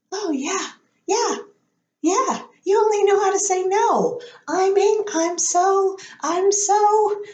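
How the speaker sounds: high-pitched princess sputtering with new-found anger; Amy Adams. Retold or imitated. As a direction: play a flustered princess working up to anger. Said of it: "realizing she's super angry about things".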